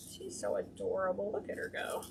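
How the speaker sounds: soft whispered marvel. Real, not acted. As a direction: hush it like a secret. Whispering over the ribbon.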